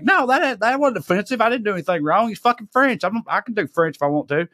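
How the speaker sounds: high-pitched defensive rant, acted out; indignant. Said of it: "this whole thing blazing in my head".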